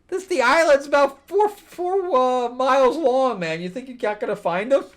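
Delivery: high-pitched, loud disbelief; real incredulous outburst. Real, not acted. Incredulous rant at the plot.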